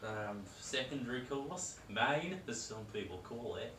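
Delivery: put-on broad Australian accent, half-joking; a mock Aussie cooking guest; hammed-up Aussie accent.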